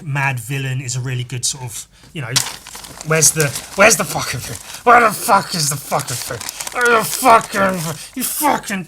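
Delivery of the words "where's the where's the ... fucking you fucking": louder, rising frustrated swearing; getting more irritated as the thing stays lost; frustrated outburst. Real, not acted. Frustrated search for lost item.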